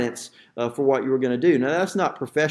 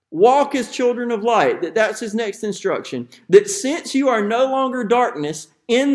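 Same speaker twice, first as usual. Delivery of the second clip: raised, ringing pulpit voice; preaching at full force; 5 semitones higher, 3 dB louder than usual. Acted. Pastor exhorting his church, preaching with rising force. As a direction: drive each line home to the congregation.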